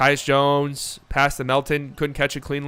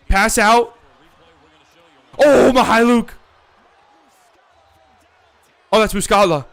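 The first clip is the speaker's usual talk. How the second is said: louder, much higher clutch call; excited shout; 7 semitones higher, 7 dB louder than usual. Real, not acted. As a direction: call the big shot at full voice.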